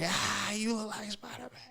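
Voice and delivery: high, breathless imitation of her laugh; imitated high-pitched laughing tease; mock laughing taunt. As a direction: squeal it high and airy, fading out. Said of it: "but don't, like, just laugh".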